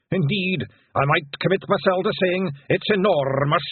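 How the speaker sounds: high, declaiming Scots bard; pompous Scottish pronouncement with rolled r's; William the pixie. A narrator's reading. William answers in bardic Scots.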